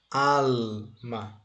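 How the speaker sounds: "Alma" split into "al" and "ma"; drawn-out "Al... ma" for a learner; syllable by syllable. Shown as a demonstration. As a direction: break "Alma" in two with a gap between the syllables. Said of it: "Nós vamos separá-la".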